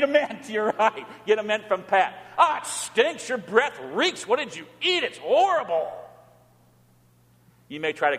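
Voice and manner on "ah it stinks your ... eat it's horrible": high, swooping disgusted outburst. Acted. Reacting to someone's bad breath, exaggerated disgust. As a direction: recoil in mock disgust, pitch swinging up.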